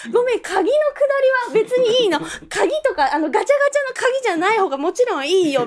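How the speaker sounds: high, mock-patient "sorry, not the key part"; exasperated correction. Speaker retelling how she corrected the staff, high exasperated tone.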